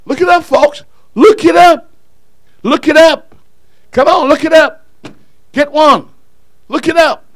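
loud, high repeated urging; urging shout. Real, not acted. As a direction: shout it at them until they move. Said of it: "preacher urging congregation".

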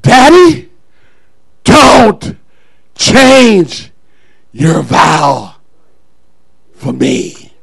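loud, separated words dropping low; emphatic acted plea with long pauses; daughter's solemn plea. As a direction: punch each word, then sink down. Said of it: "You know what she said?".

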